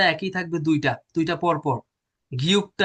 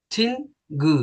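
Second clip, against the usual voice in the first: ordinary talk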